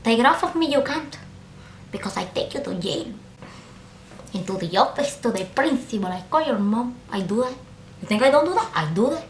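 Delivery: put-on teacher voice, soft and smug; gloating teacher. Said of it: "accomplishment in her eyes".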